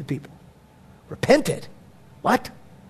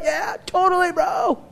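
mock casual; 9 semitones higher than usual.

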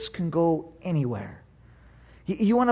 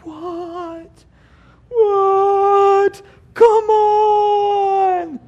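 high, loud wailing "what, come on"; whining protesting child; 11 semitones higher, 5 dB louder, much slower than usual. Retold or imitated. Dad imitates his kids protesting bedtime, high whiny wail. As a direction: cry out like a kid sent to bed early. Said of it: "That's not how it went".

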